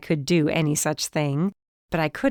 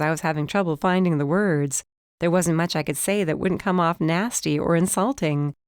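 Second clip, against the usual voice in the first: ordinary delivery, nothing marked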